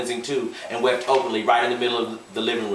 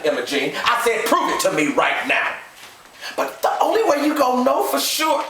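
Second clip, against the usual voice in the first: angry demand. A narrator's reading. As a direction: demand proof in a rage. Gus demanding proof angrily.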